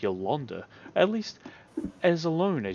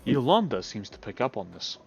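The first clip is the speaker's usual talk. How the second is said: ordinary talk, nothing marked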